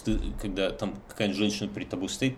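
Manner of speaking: ordinary talk, nothing marked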